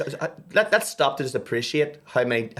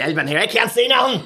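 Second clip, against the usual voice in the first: higher and louder grumbling; hammed-up cranky gran; mock complaint; 9 semitones higher, 5 dB louder than usual. Grandmother griping from inside her coffin, higher and louder.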